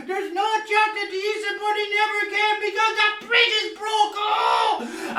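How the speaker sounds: put-on high-pitched kids' protest about the bridge; complaining children. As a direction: whine like cheated kids.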